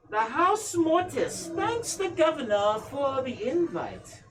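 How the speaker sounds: high, slow, cold noblewoman voice; Lady Amelia. Acted. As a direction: speak as a haughty, grieving matriarch.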